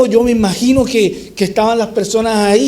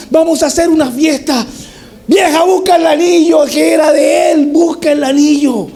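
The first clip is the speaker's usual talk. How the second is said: jubilant father; 5 semitones higher than usual. Acted. Father orders servants and wife to prepare the feast, high excited. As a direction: throw a party, bursting with joy.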